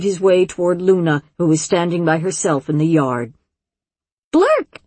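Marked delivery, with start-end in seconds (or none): 4.3-4.8 put-on high, loud child's call. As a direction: call his name across the yard. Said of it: "she called".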